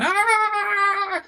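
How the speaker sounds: a shrill, hammed-up scream of someone in a trance; wild mock shriek. Preacher mocks a frenzied worshipper with a shrill, laughing scream. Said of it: "y gritan".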